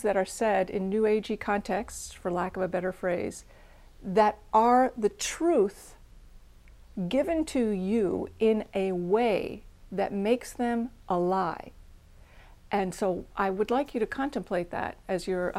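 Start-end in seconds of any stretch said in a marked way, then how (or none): 4.0-11.7 slow each word right down to land the point; slow emphatic point. Real, not acted. Teacher to students, warning about New Age truths: slow and emphatic. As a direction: lean on it, word by word, very slowly.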